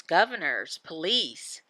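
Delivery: ordinary delivery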